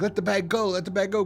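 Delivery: high-pitched mock urging; retold cry, pressing and repeated; urgent calling out. Group urging Bob to drop the bag, urgent.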